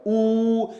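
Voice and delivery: 'ऊ' held on a level oo; drawn-out oo in 'ऊ'; long vowel. Shown as a demonstration. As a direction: sustain the sound 'ऊ'. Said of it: "ये बड़ा ऊ है".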